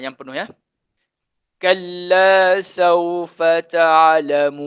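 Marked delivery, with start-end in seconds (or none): 1.6-4.6 slow chanted verse; teacher rereads the third verse slowly for students; full verse recitation. Shown as a demonstration. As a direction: recite the whole line slowly. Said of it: "baca sekali".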